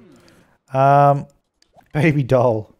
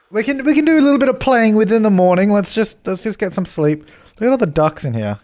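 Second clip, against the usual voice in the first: coaxing; 8 semitones higher than usual. Acted. Coaxing sims to bed.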